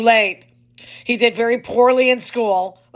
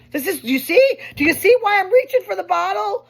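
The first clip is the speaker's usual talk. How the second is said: exasperated plea; 10 semitones higher, 4 dB louder than usual. Acted.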